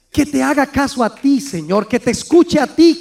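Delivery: ordinary talk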